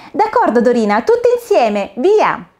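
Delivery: rally everyone to begin; cheerful start. Acted. Caterpillars call everyone to recite together, excited.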